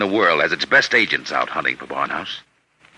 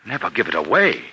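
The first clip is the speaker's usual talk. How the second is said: ordinary talk, nothing marked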